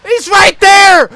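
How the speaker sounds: high, loud, drawn-out yell; frantic shout. Real, not acted. Gamer spotting the enemy, a frantic high shout. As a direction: scream the warning. Spots enemy mid-fight.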